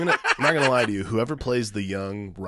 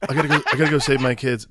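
mock Peter; 3 semitones higher, 5 dB louder, faster than usual. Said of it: "I like the idea that it's".

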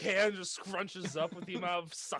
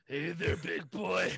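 lightly voiced seductive woman; mock flirty come-on, half-hearted; failed seductive; 2 semitones lower, slower than usual.

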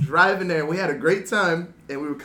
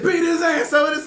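raised, high goading voice with laughter; retold yell; 11 semitones higher, 6 dB louder than usual. Passenger egging on driver against next car, yelling. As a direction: imitate a passenger yelling a challenge. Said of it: "he yells at me".

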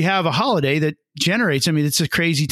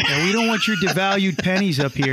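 Canadian border guard. Retold or imitated. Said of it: "the Canadian border guard's like".

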